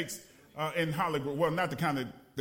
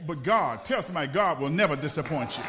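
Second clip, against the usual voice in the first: preaching call-out; 4 semitones lower, 6 dB louder than usual. Acted. Preaching callout.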